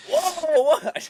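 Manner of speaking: high, loud whoa of disbelief; shocked. Real, not acted. Co-host reacts to a French toast pick, loud and shocked. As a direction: balk at the pick, voice jumping up.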